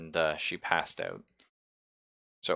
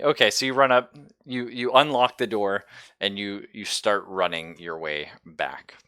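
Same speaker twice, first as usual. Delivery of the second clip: normal talking voice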